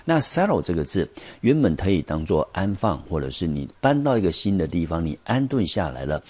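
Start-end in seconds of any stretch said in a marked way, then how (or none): none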